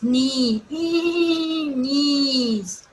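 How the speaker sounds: drawn-out, rising "neee" whinny; imitated horse neigh. Shown as a demonstration. Teacher shows children how a horse neighs, mock whinnying. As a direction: stretch the word into a whinny.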